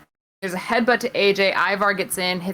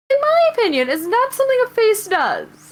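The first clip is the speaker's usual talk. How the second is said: high, emphatic mock-serious verdict; mock pompous high voice; 4 dB louder than usual. Real, not acted. Co-host mocks a heel's behaviour, high mock-serious voice. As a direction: lift the voice way up for a mock-serious ruling.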